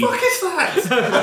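laughing, slow and incredulous; amused what is that; laughing disbelief. Real, not acted. Reacts to odd title.

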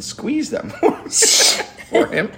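laughing at his own story; laughing through it. Real, not acted. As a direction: crack up mid-sentence. Laughing at punchline.

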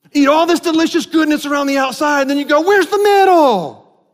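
mock whining about a donut's missing middle; mock complaint. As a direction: play the outraged customer. Mocking a complaining donut eater.

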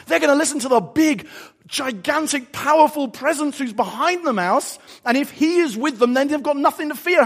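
high, loud, impassioned sermon delivery; full-force preaching. Real, not acted. Preacher telling congregation it's not about the mouse, impassioned. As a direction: drive it home emphatically to the crowd.

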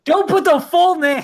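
high, slow squeal of fake alarm; mock panic. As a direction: pretend to panic about being found. Joking panic over full name.

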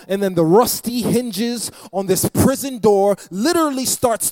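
whooping sermon crescendo; preaching at full force. Acted. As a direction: build it up like a revival preacher. Preacher building to climax.

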